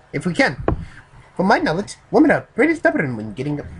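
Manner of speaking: high, loud, badly faked Japanese accent; exaggerated Japanese accent. Reader retries the Japanese man's line, stronger Japanese accent. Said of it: "I can't do a Japanese accent".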